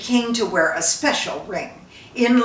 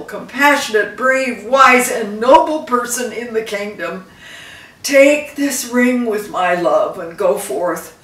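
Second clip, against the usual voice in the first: a hint of a solemn king blessing his son; lightly voiced dying king; 3 semitones higher, 8 dB louder than usual. Dying king blessing his son at the bedside, solemnly. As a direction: give it like a last blessing. King's farewell to second son.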